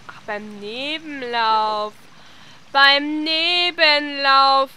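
Nebenlauf drawn out very slowly; slow realization. Real, not acted. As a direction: stretch Nebenlauf as it dawns. Realizing the clue. (Nebenlauf ganz langsam gedehnt)

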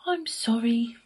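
high, sorry little sigh. A narrator's reading. Wolf turned away by the rude pig, sighing. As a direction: sigh it apologetically. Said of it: "sighed the wolf".

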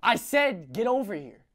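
menacing father. Acted. Father's slow threat.

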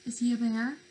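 normal talking voice

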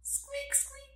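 tiny, very high, soft squeaks; squirrel squeak. Pretend. Teacher introducing the squirrel, tiny high squeaks. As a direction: squeak softly like a little squirrel.

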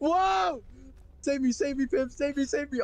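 high frantic pleas; panicked screaming. Real, not acted. Falling in game, begging.

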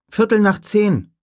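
put-on a curious little girl asking; young girl voice. Lex asks Grant about the raptor, high child voice.

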